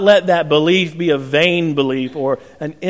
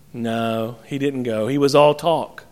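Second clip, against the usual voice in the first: normal talking voice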